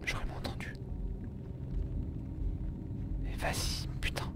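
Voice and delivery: hushed whisper, unvoiced; whispering while hiding. Real, not acted. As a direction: whisper so the monster won't hear.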